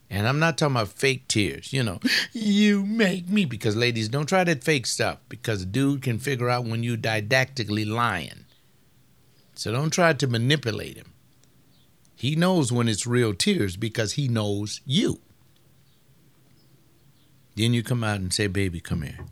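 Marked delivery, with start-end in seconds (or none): 2.3-3.4 higher, slow whiny mock sob; mock fake crying. Preacher mocking a wife's fake crying, high and drawn out. 18.5-19.3 softer, lower call; gentle husband. Retold or imitated. Husband gently calling crying wife, soft and low.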